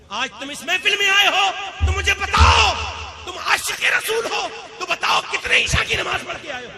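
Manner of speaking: high, loud shouted challenge to the congregation; full-force preaching. Real, not acted. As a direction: thunder the questions at the crowd. (پورے جوش سے بلند آواز میں للکار)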